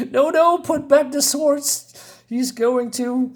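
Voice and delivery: a hammed-up, lofty hero easing his crew off; Conan calming his men. Reteller voicing Conan telling pirates to sheathe swords, high and slow. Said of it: "He says".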